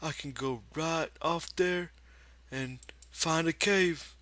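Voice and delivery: a big simple man talking to himself; Lenny's voice. A narrator's reading. Lenny alone by the pool, talking to himself, in a high childlike voice. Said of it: "he said".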